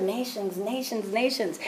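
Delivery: hushed, soft repetition of each word; soft inner voice. Retold or imitated. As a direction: let it echo softly like a voice in the heart. Hearing God's word in her heart.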